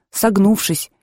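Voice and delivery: ordinary delivery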